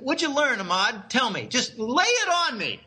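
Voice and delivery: ordinary talk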